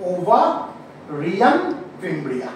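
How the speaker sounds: slow, split term, then a low falling end; slow dictation. Shown as a demonstration. Teacher has the class learn the term, slow dictation. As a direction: draw out ovarian in separate parts, very slowly.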